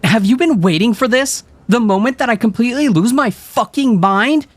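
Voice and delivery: very high, loud furious rant; girl losing her mind at her friends, furious; manic and furious. A narrator's reading. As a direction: lose it completely at them. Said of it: "manic and furious".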